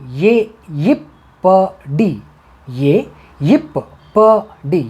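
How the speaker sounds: यिप्पडी spelled out syllable by syllable, very slow; teacher drilling यिप्पडी slowly; slow teaching repeat. Shown as a demonstration. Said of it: "इसको हम तमिल में ऐसे बोलेंगे".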